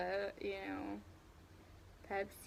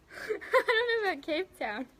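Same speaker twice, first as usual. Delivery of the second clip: laughing; 6 semitones higher than usual. Real, not acted. Laughing at own geography slip.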